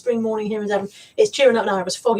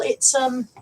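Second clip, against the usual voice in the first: ordinary talk, nothing marked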